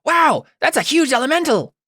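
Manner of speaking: very high, squirrely wowed voice; high-pitched awestruck mimicry. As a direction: squeal it in a squirrely character voice. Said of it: "high and squirrely".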